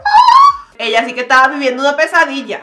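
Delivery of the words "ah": very high scream; horrified scream. Real, not acted. Vlogger reacting to botched haircut, scream. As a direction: let out a short scream.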